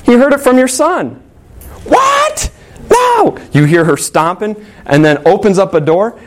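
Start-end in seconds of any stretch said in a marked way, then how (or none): 0.0-1.2 accusing mom. Retold or imitated. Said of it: "she goes". 1.8-3.4 very high shouted what, no; outraged shriek. Retold or imitated. Mrs Schwartz learning her son is to blame, shrieked outrage. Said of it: "And Missus Schwartz says".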